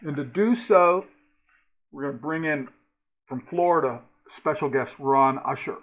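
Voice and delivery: loud, punchy video intro read; announcer voice. Acted. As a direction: announce the show.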